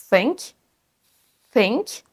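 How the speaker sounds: careful English think; correct TH. Shown as a demonstration. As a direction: model think, tongue between teeth. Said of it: "la bonne prononciation du son T H".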